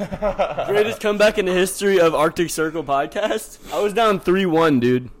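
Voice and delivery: loud, high triumphant laughing; excited celebration. Real, not acted. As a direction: crow about the comeback. Wins the game, celebrating.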